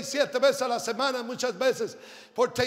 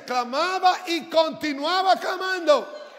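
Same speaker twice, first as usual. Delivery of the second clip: higher, slow, raised proclamation; emphatic preaching; 8 semitones higher, 8 dB louder, slower than usual. Real, not acted. Preacher telling how he kept crying out, slow emphatic preaching. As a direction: stretch it out and build the volume.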